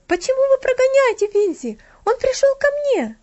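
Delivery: put-on very high, loud, imperious girl; a little girl giving orders; imperious demand; commanding tone. A narrator's reading. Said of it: "сказала она повелительным тоном".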